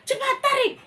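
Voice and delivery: high, loud order to pull; urgent shout. Acted. Chief ordering the child to pull the rope. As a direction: snap the command out.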